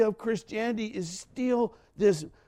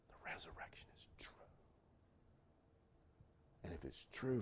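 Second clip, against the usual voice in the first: hushed whisper; 14 semitones lower, 22 dB quieter than usual. Real, not acted. Preacher to congregation about seeing his daddy, whispered. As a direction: drop to an intimate whisper. Sudden hush after shouting.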